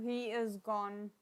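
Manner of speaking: ordinary talk, nothing marked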